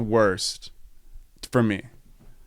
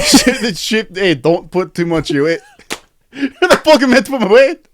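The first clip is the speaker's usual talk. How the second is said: put-on high, loud Scottish accent rant; mock Scottish accent; 7 semitones higher, 4 dB louder than usual. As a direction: yell it in a hammed-up Scottish accent. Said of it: "That's, like, Scottish".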